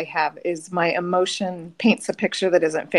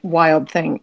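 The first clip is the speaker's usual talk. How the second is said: ordinary talk, nothing marked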